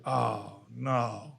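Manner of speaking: low, hushed reaction; retold quiet dismay. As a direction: drop into a soft, sinking recollection of dread. Retelling his reaction to pregnancy news.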